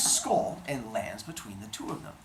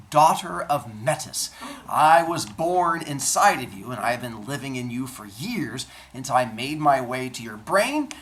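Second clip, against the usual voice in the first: proud warrior declaration; 7 dB louder than usual. Acted. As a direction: proclaim it like a warrior goddess. Athena introduces herself boldly.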